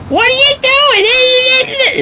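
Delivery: very high, drawn-out mom shriek; annoying mom voice. Acted. Comedian's mom in his answering machine bit, annoying screech. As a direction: screech it slowly as a maddening, caricatured mother. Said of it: "It's annoying as fuck".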